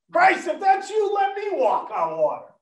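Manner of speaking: Peter calling out high, then settling; eager Peter. Acted. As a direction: call out to Christ, eager and high. Peter calls to Christ on water.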